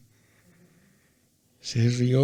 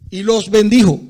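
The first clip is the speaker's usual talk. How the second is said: emphatic shout; 6 semitones higher, 12 dB louder than usual. Real, not acted. Preacher quoting scripture aloud.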